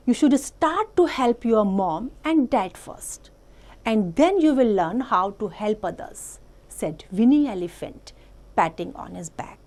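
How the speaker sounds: slow, deliberate read-aloud voice; story reading. A narrator's reading. Teacher reading an English story to the class, slow and clear. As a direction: read it out slowly for small children.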